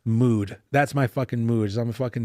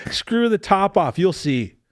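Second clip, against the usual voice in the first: ordinary talk, nothing marked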